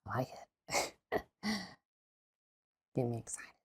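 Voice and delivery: soft breathy whisper, no voice; playful unvoiced aside; teasing whisper. Real, not acted. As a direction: whisper it like a private tease.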